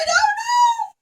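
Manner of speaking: very high, loud alarmed cries, slowed; dismayed crowd. Acted. Everybody cries out over the lost crown, alarmed. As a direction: gasp in horror. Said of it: "And everybody said".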